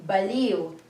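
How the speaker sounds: baliw said as ba-liu with a rounded u ending; Filipino word baliw. Shown as a demonstration. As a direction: baliw with the iw gliding into u. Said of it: "and then we say".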